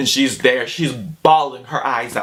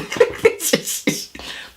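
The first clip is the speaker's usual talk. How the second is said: airy high-pitched laugh bursting out; high breathless laugh. Real, not acted. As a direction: laugh helplessly at the memory. Laughing at own story.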